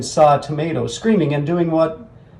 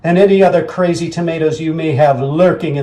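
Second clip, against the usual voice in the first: declaring war; 3 semitones higher, 4 dB louder than usual. Acted. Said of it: "Oliver yelled".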